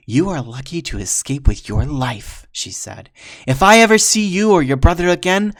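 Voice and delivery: loud rising warrior threat; menacing warrior fury; furious threat. Acted. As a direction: promise to destroy them.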